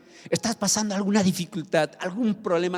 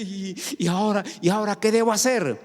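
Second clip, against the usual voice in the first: desesperado; 3 semitones higher, faster than usual. Acted. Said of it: "desesperados quieren matarse".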